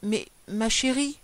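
slow, helpless appeal; understated dismay, drawn out; despairing plea. A narrator's reading. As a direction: plead weakly with an angry wife. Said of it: "Il se désespérait".

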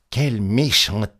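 slow, drawn-out mild outcry; indignant exclamation. A narrator's reading. Petit Claus after the attack, mildly exclaiming. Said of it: "s'écria Petit Claus".

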